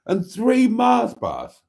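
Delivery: high, loud and slow, each word drawn out; scandalised at his own greed; mock outrage. Real, not acted. Telling off himself for the chocolate, mock horror. Scolding himself over snacks.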